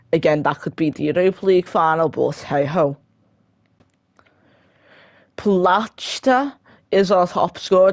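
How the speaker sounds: usual speaking voice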